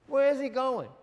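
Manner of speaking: loud, high and incredulous; astonished question. Real, not acted. Preacher to congregation, loud disbelieving question.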